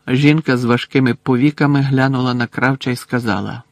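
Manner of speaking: usual speaking voice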